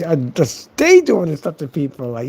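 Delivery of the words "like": ordinary delivery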